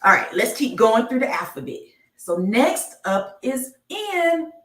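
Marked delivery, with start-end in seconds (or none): 2.2-4.5 slow, higher, stretched letter reveal; slow sing-song reveal of the next letter; drawn-out announcement. Acted. Slow game-show style letter reveal.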